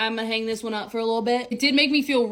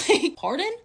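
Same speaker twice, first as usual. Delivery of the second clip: incredulous; 7 semitones higher than usual. Real, not acted. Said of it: "outraged disbelief at his behaviour".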